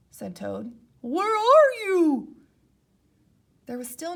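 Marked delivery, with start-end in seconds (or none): 1.0-2.4 high, loud, slow anxious call; worried call. Acted. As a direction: search for Frog anxiously. Toad worried.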